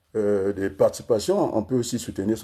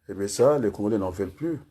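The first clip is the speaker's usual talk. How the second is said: ordinary delivery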